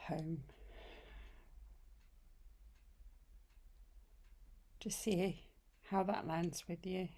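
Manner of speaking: unhurried meditation voice with long pauses; guided meditation. Acted. Yoga teacher welcoming listeners home to yoga nidra, guided meditation. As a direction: lead them gently into rest, phrase by phrase.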